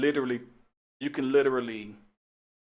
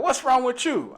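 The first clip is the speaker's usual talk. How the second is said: high, louder indignant retort; snapping back; 13 semitones higher, 5 dB louder, slower than usual. Retold or imitated. Angry lady turning the question on the officer, indignant. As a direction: fire the question back at him. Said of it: "And she was like".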